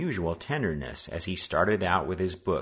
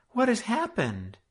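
put-on frightened girl. A narrator's reading. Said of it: "she asked tremulously".

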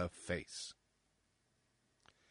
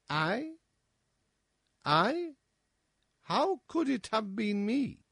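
much higher, feigned innocent protest; innocent dwarf; 13 semitones higher than usual. Acted. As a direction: act surprised and blameless. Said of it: "merely ejaculated".

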